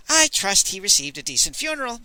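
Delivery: a vain, playful dandy; lightly voiced Moon Boy. Moon Boy's voice.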